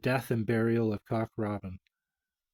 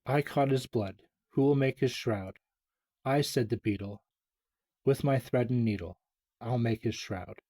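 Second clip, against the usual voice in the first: reading a rhyme aloud, a little louder; nursery rhyme reading; 4 dB louder than usual. Acted. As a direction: recite it like a children's poem.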